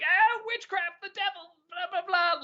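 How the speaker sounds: high, strained mock-panic cry; mock hysteria. Mocking old Harry Potter complainers.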